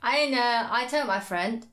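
ordinary talk

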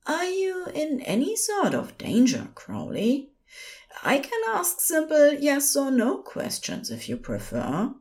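high, reassuring nurse voice; soothing. A narrator's reading. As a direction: gently question a frightened patient. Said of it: "the soothing panic voice".

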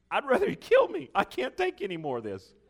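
high, loud pleading wail; desperate cry. Retold or imitated. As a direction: beg to die, half laughing at it. Moses voiced in desperation.

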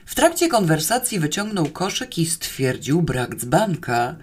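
usual speaking voice

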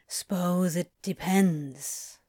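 slow, musing low voice; pensive, drawn-out murmur. A narrator's reading. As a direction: muse on it slowly, keeping it low. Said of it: "his voice low and pensive".

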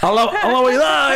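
a la verdad in a hammed-up Cibao accent, laughing. Shown as a demonstration. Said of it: "es un acento cibaeño". (acento cibaeño exagerado)

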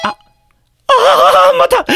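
panicked wail. Acted. Panic at phone ringing again.

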